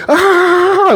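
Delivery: long, high-pitched "ah"; drawn-out squealed attack cry; mock battle yell. Sam pops up to blow at him, mock yell.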